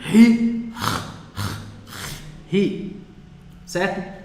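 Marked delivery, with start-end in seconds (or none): 0.1-0.7 loud ri rasped from the throat; guttural ri. Shown as a demonstration. Teacher demonstrating the throat sound: loud rasping ri. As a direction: hit ri hard from the throat. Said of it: "Tem que usar a garganta".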